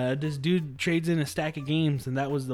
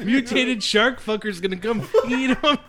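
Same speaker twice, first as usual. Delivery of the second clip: higher, amused mock prediction; laughing retelling; 6 semitones higher, 4 dB louder than usual. Player jokes about expecting a monster on the beach, laughing. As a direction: laugh through the absurd guess.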